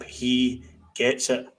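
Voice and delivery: slow, weighted summing-up; slow emphatic repeat. Shown as a demonstration. Pundit summing up Lundstrom, slow and emphatic. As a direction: lay each word down slowly.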